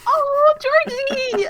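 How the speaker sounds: high, drawn-out affectionate squeal; gushing affection. Real, not acted. Guest answering Georgie's loving message, high gushing coo. Touched reply to fan's message.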